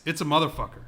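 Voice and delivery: ordinary delivery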